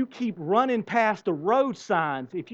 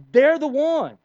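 angry; 8 semitones higher, 8 dB louder, slower than usual. Retold or imitated. Anger at someone else.

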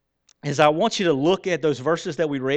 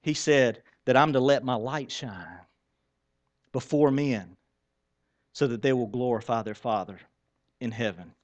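usual speaking voice

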